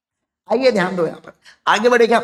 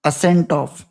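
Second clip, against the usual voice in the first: stretched out phrase by phrase, lower; slow dictation; 5 semitones lower, much slower than usual. Shown as a demonstration. Teacher dictating the rule to students, slow and lower. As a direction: spell it out slowly for note-taking.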